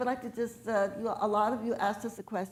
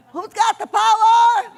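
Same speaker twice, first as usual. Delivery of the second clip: slow, much higher and much louder rally shout; shouted rally call; 10 semitones higher, 16 dB louder, slower than usual. Real, not acted. Leading the crowd in a rally chant, loud shout. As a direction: bellow it out to the crowd, stretching every word. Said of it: "I want you to shout it so loud".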